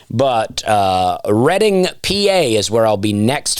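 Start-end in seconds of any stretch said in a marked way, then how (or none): 1.3-1.9 "Reading" high, as RED-ing; corrected pronunciation. Real, not acted. Comedian repeating the corrected town name, "Reading" as high RED-ing. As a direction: punch "Reading" high, rhyming it with wedding. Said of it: "received about my pronunciations".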